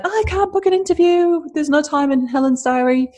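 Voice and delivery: high, put-on complaining voice, pitched well up; mock whiny listener. Host imitating a listener who can't get an interview, high mock whine. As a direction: lightly mimic a frustrated listener who can't book.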